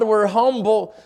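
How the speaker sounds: ordinary delivery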